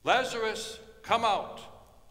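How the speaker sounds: loud high call to the tomb; shout. Acted. Jesus calls into the casket, full shout. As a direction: ring it out to wake the dead. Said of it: "Jesus shouts".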